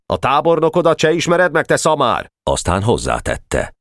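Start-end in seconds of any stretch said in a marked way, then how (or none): 0.1-2.3 high, sharp telling-off; indignant commander's rebuke; scolding shout. A narrator's reading. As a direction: scold a foolish subordinate in a raised pitch. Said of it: "Boka pedig rákiáltott".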